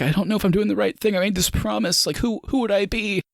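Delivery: high, quickened mock-whiny worry; mock tearful. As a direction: imitate a distressed, crying boyfriend. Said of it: "he's, like, crying for some reason".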